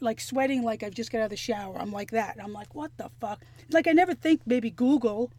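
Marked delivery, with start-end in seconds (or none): 2.7-3.4 softly muttered what the fuck, much quieter; half under the breath, baffled; quiet mock disbelief. Retelling confusion, alone at home.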